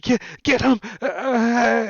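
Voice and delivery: high, loud, stammered order; frantic goblin command. Acted. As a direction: yell it in a panic while backing off. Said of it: "he's like".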